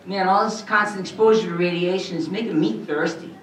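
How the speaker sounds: high, boyish cartoon voice; youthful cartoon teen; adolescent voice. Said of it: "kind of an adolescent voice".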